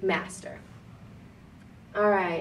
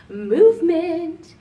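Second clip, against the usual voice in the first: announcer-style; 8 semitones higher, 6 dB louder, slower than usual. Shown as a demonstration. Announcing next topic.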